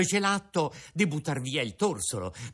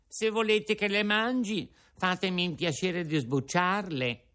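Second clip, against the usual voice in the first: put-on high, fast boyish puppet voice; a hungry puppet pouring out his story; puppet Pinocchio; 7 semitones higher than usual. Pinocchio telling Geppetto his hunger woes, high puppet voice. Pinocchio demands peeled pears.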